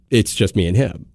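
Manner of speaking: usual speaking voice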